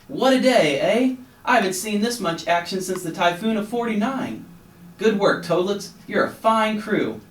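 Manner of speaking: high, loud hearty excitement; a salty captain buzzing with the action; jolly sailor. A narrator's reading. Captain celebrates the rescue, high loud jolly talk.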